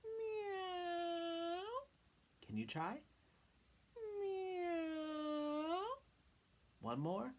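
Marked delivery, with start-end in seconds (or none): 0.0-1.6 high, long-drawn meow; very high, sustained cat's meow; sweet kitty meow. Shown as a demonstration. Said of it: "sound like a kitty cat". 4.2-5.8 high, long-drawn meow; very high, sustained cat's meow; sweet kitty meow. Shown as a demonstration. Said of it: "sound like a kitty cat".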